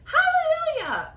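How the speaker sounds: very high, loud cry of joy; joyful shout. Acted. Aunt shouting with joy at the huge peach. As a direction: whoop it to the sky. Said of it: "Aunt Spiker shouted".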